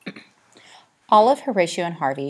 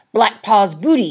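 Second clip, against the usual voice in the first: loud, high excited exclamation; exclaimed; 5 semitones higher, 11 dB louder than usual. A narrator's reading. Harvey shouting about pirate treasure, loud and thrilled. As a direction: burst out with wild glee. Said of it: "he exclaimed".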